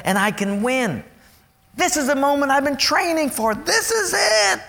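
rising, emphatic preacher's build; fervent preacher's climax; preaching. Acted. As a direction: drive it home to the congregation.

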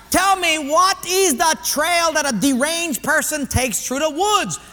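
personnel guy, insistent. Acted. Personnel guy puts the next question, loud and insistent. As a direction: pose it loud and high, like a pidgin quizmaster.

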